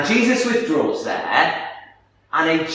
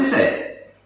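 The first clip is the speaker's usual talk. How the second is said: usual speaking voice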